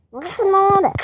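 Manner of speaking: high, loud mock video intro; called-out greeting. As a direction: call out a big hello to everyone.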